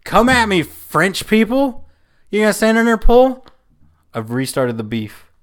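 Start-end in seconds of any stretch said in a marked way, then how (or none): 0.0-3.5 high, loud mock provocation; taunting challenge. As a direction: call them out, cocky. Taunting French people.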